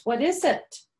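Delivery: lightly voiced curious boy. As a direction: ask like an excited little boy. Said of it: "And the boy says".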